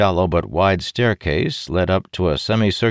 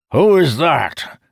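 slow, loud, higher demand; demanding shout; 11 semitones higher, 6 dB louder, slower than usual. Acted. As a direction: demand an answer. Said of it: "Calimere demanded".

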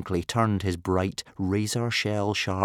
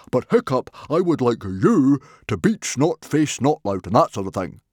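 loud, higher, hearty chief; hearty booming chieftain; 5 semitones higher, 8 dB louder than usual. A narrator's reading. Stoic urging Hiccup to beat his cousin, booming. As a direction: boom at your son with gusto.

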